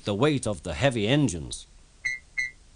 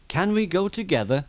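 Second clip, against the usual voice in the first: hopeful; 8 semitones higher than usual. Acted. Thomas asking hopefully.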